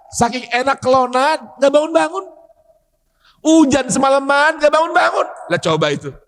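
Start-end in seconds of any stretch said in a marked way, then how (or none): none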